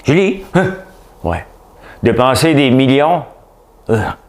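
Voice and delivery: dozing official startled awake, acted; Julie Payette. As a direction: jolt awake mid-speech, confused. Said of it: "Julie Payette, euh, elle s'endormait hier".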